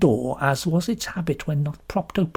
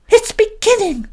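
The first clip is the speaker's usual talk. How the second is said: much higher, louder delighted cry; cried in ecstasy; 5 dB louder than usual. A narrator's reading. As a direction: squeal with delight. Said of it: "cried Missus Harmon in ecstasy".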